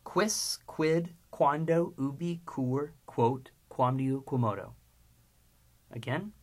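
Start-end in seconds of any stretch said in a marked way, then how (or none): 0.0-4.7 slow, spaced-out list, word by word; slow recitation. Shown as a demonstration. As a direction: recite the Latin list slowly and evenly. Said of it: "Here's the list three times slow".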